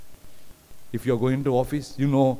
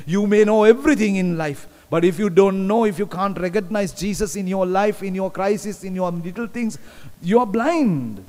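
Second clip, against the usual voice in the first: higher, louder preaching climax; full-force preaching; 7 semitones higher, 3 dB louder than usual. Acted. Preacher to congregation, rising passionate preaching. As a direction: preach it out, building to the peak.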